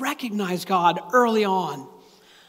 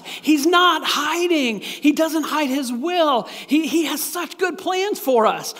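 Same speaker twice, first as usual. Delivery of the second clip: preaching at full force; 5 semitones higher, slower than usual. Real, not acted. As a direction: drive it home to the congregation.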